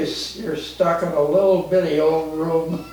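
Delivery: usual speaking voice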